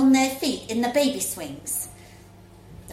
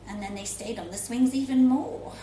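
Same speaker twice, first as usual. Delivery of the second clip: usual speaking voice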